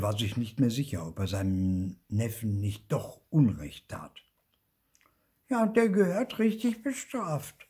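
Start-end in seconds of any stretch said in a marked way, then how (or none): 5.5-7.7 high, loud little ghost agreeing; high-pitched righteous agreement; Zipfel. A narrator's reading. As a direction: agree eagerly in a piping voice. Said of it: "sagte Zipfel".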